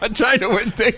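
high, whiny mock voice; mock woman. Imitating the woman on the radio call in a high whiny voice. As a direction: whine it like a spoiled caller.

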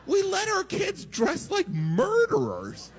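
exasperated shout. Acted. Standup punchline, shouted.

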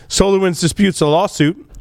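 ordinary talk

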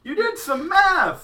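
high, slow, delighted echo; excited. Real, not acted. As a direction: gasp it out in thrilled disbelief.

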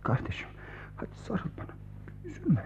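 very soft, hushed tenderness; comforting whisper. Acted. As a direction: hold him and whisper. Comforting friend with hug. (sarılırken teselli eden fısıltı)

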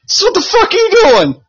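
high, outraged mock yelling; imitating an angry call. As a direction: play the furious friend calling from the car. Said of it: "He's just gonna call us".

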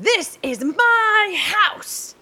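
high, drawn-out catchphrase cry; awful attempt at a British wrestler's yell; Paige. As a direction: stretch it out high in a bad imitation. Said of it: "That was awful imitation".

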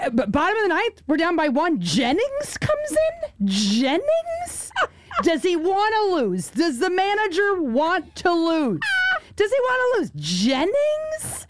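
high-pitched outraged mother impression; fuming mom. Daughter imitating her mom raging over a lost game, fuming. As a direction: rant in mock disbelief, pitch leaping up on each question. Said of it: "she was fuming".

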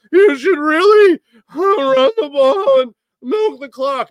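a squeaky analyst begging; nerdy analyst voice. Retold or imitated. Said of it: "analytical department... be like".

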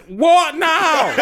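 high, drawn-out defiant shout. Real, not acted. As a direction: shout it as a challenge. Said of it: "It's time to shout".

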